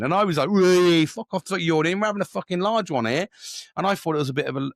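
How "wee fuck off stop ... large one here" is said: high, loud playful outburst; rowdy teasing. Retold or imitated. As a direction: rib a mate on a night out. Re-enacting his rowdy prank.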